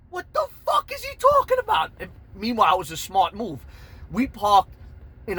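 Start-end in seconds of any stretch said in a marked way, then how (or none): 0.1-1.9 high, loud disbelief; baffled yell. Retold or imitated. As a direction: shout it in bewilderment. His baffled reaction amid shouting.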